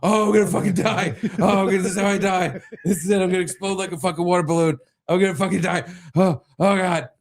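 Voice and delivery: high, hurried, hammed-up despair; panicked agony. Husband retelling his failed bathroom trip, in comic panic. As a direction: act convinced the pain will kill you.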